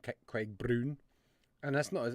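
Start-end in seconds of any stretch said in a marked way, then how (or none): 0.0-1.0 lower, badly faked Scottish accent; terrible Scottish accent. Host repeats a viewer's name, terrible Scottish accent. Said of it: "that's a terrible Scottish accent".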